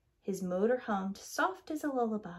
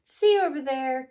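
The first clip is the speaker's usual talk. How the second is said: calling out; 4 semitones higher, 9 dB louder than usual. Acted. Crane calls out, pointing.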